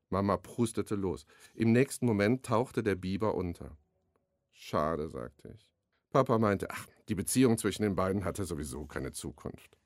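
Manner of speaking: reading his youth novel aloud, lightly voicing the family; a relaxed author's reading voice; story reading. Author reads a scene from his novel on the radio, story reading.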